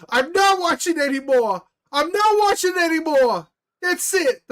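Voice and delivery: high, loud mock wail of a crying mom; imitating screaming mom. Streamer imitating a sobbing mom quitting the show, high mock wail. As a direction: shriek it like a mom in tears. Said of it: "she's screaming and she's like".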